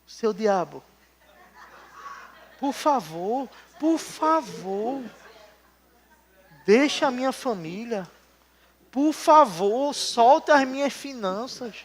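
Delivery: soft, slow begging voice, mocking a timid believer; hushed pleading prayer. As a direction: plead meekly with the devil. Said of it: "Tem gente que fala".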